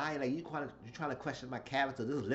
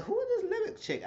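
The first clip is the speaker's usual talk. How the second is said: pitched way up in mock disbelief; high mock disbelief. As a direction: toss the question up high, incredulous and mocking. Retold question about newcomer.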